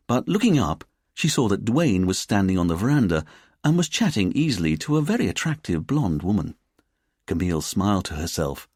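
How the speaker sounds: ordinary delivery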